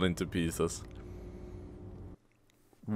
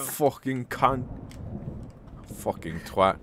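drawn-out British swearing; mock British accent; slower than usual. Player teases friend with insults, mock British accent. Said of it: "as soon as I turn British".

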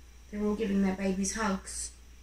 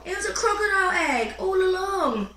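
high, loud triumphant reveal; spring the surprise on her; excited reveal; 9 semitones higher, 7 dB louder than usual. Acted. Big reveal to child.

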